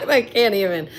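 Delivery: words breaking out of a laugh; real laughter. Real, not acted. Streamer cracking up at own online show, real laughter. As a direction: laugh first, then talk through it.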